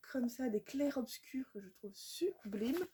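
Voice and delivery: hushed, breathy words of awe over the pages; awed whisper. Real, not acted. Showing sublime drawings to viewers, an awed whisper. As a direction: whisper it as if the pictures take your breath.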